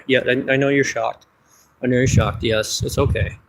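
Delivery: normal talking voice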